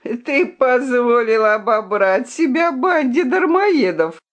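high, jeering taunt; mocking. A narrator's reading. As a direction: rub his nose in it. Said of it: "насмехался рыцарь".

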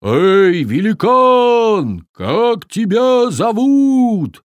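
loud, slow bold call; shouting. A narrator's reading. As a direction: shout up bravely at the giant. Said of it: "и закричал".

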